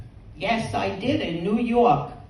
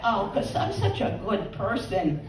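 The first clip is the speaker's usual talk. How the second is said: usual speaking voice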